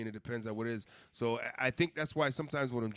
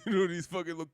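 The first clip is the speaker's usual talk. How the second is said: normal talking voice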